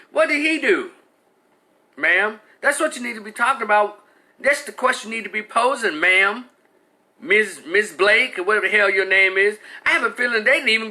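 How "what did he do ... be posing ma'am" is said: high, heated questions to her; high exasperated challenge; indignant. Real, not acted. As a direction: demand answers from her, pitch raised. Confronting the mother directly.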